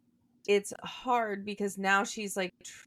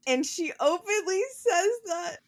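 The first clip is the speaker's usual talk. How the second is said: high, squealing voice breaking into laughter; laughing while talking; 12 semitones higher, 4 dB louder than usual. Real, not acted. Podcaster cracking up at co-host's yawning, high laughing voice. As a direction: try to finish the sentence through real laughter. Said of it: "I'm laughing 'cause Ashley keeps yawning at me".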